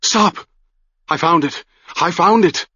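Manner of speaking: high-pitched cry at moderate volume; urgent calling out. A narrator's reading. Madman catching up with the travelers, urgent high calling. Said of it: "he shouted".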